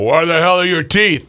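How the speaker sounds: lightly voiced crusty old man; a hint of Louis Cardinal. Uncle demanding where his wife's teeth are. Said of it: "like Louis Cardinal".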